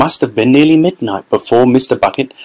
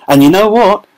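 higher, louder crowd call; shouted back; 9 semitones higher, 4 dB louder than usual. A narrator's reading. Second onlooker replying, shouting back. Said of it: "someone else shouted back".

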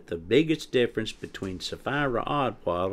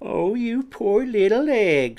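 high, louder mock pity; teasing; 12 semitones higher, 5 dB louder than usual. A narrator's reading. Quinny to the startled Peck, teasing in mock sympathy. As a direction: coo it mockingly, way up high. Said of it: "said Quinny teasingly".

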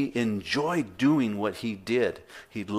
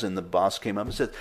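ordinary talk

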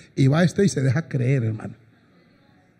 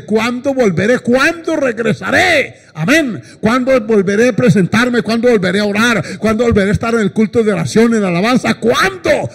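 high, pleading cries of a yearning soul; impassioned preacher voicing a plea; ardent longing; 6 semitones higher, 4 dB louder than usual. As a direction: preach the longing, each question pitched high and rising to a peak. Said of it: "su alma le reclama y él anhela ardientemente".

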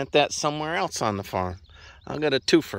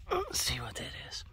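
a curious little whisper to himself; 14 dB quieter than usual. Real, not acted. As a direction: breathe it, no voice. Peering at a strange plant.

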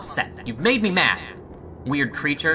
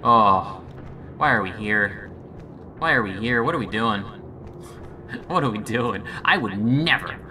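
normal talking voice

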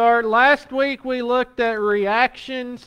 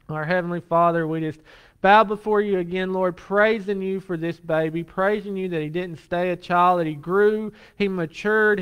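low, steady pastoral prayer voice; earnest prayer; 4 semitones lower than usual. Real, not acted. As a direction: pray sincerely for the congregation. Leads the congregation in prayer.

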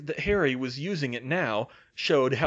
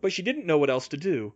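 usual speaking voice

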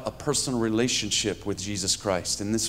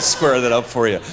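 normal talking voice

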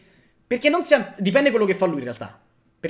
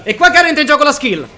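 louder, higher hype; excited; 5 semitones higher, 7 dB louder than usual. Real, not acted. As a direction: hype it up loudly. Hyped moment in the game.

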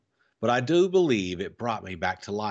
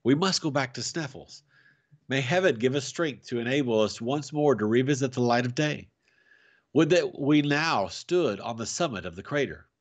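ordinary delivery, nothing marked

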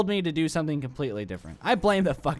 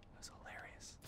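unvoiced whispered repeat; hushed whisper; 27 dB quieter than usual. Shown as a demonstration. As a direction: breathe it out as a whisper. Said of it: "repeats the line as a whisper".

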